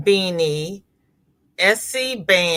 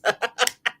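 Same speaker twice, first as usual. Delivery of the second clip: giggling laugh; 4 semitones higher than usual. Real, not acted. Amused that a viewer says it to kids, giggle. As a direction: break into a giggle.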